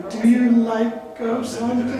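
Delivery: slow, high-pitched timid questioner; mimicked audience member. Host mocks a pity question from the crowd, slow and high. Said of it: "And someone's like".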